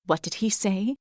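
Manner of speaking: hushed, questioning forest creature; grumbling animal. A narrator's reading. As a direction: murmur a puzzled question from the crowd. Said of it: "continued to grumble and question".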